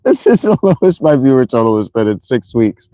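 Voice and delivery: helpless laughter breaking up the words; laughing. Real, not acted. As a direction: keep cracking up, restart the sentence through giggles. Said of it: "That's why I'm laughing".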